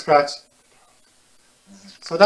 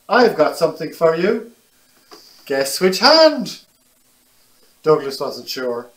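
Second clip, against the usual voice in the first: put-on lively, animated read to children; picture book reading; 4 semitones higher, 5 dB louder than usual. A narrator's reading. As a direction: tell it brightly to little listeners.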